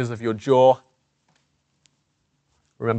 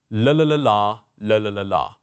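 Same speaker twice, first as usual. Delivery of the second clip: la la drill with pencil propping jaw; pencil in mouth; 3 dB louder than usual. Shown as a demonstration. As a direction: chant la la with a pen between teeth. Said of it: "what you're going to say with the pen or pencil in your mouth".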